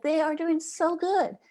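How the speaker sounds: quoting the mother's high, delighted voice; mother beaming about her boys, high and delighted; joyful. Retold or imitated. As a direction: let the joy lift the pitch high. Mother's joyful reply retold.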